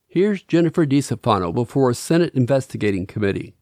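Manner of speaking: usual speaking voice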